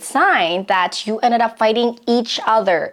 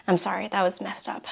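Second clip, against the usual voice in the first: softer, whispered sorry; whispered apology; 11 dB quieter than usual. Acted. Partner owning the mistake to girlfriend, whispered. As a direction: breathe the apology out quietly.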